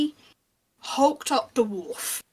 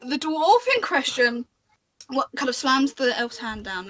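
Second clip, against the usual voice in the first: ordinary talk, nothing marked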